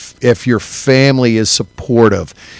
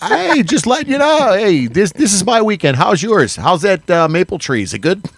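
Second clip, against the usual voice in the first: teasing brag; 7 semitones higher than usual. Retold or imitated. Teasing coworker about his weekend.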